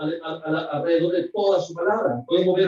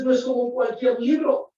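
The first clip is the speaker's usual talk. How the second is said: preaching shout; 5 semitones higher than usual. Real, not acted. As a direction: call it out to the congregation. Preacher stresses Bible's uniqueness. (exclamación predicada, más aguda y fuerte)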